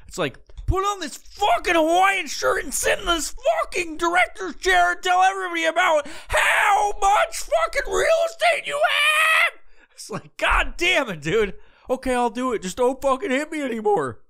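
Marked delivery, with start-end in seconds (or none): 0.6-9.6 high, strained full-on yelling; aggressive rant. Acted. Amped-up real estate guy boasting to everybody, screaming rant. 10.4-14.2 high-pitched, giving-in plea; mock cowed pleading. Cowed listener begging not to be hit, high mock pleading. Cowed reply to yelling guy.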